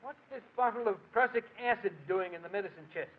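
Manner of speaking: high, alarmed discovery of poison; shocked, voice jumping up. Acted.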